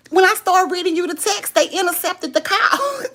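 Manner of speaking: high, loud-edged, on the verge of cracking up; laughing. Real, not acted. Telling viewers why the call dropped, laughing. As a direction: squeal it out through rising laughter. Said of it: "'cause I got to laugh".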